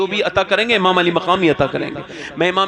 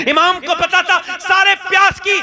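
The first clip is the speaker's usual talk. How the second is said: preaching at full force; 12 semitones higher than usual. Acted. Preacher crying out Husain's sacrifice to the congregation, at full force. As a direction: lift the voice high and proclaim it to the hall.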